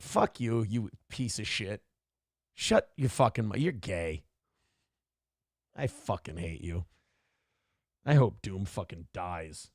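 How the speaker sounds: deadpan mock abuse. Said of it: "go over the top of your niceness".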